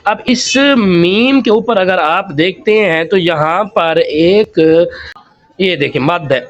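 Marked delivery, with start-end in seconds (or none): none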